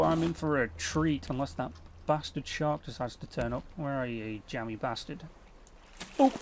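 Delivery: ordinary delivery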